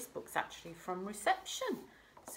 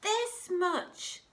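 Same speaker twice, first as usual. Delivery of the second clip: much higher and louder, eager; excited little hare; 14 semitones higher, 8 dB louder than usual. Acted. Little hare shows big hare his love, excitedly. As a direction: burst out proudly with arms wide.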